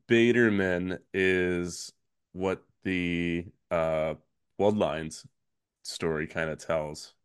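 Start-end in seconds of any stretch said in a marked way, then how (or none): none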